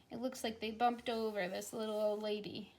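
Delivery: ordinary talk, nothing marked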